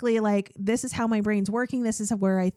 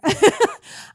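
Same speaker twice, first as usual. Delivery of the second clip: high burst of laughter; 12 semitones higher, 11 dB louder than usual. Real, not acted. Embarrassed laugh after aside.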